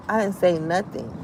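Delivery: ordinary delivery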